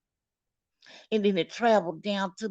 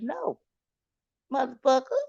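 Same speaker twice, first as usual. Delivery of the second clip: mock angry reply; 6 semitones higher than usual.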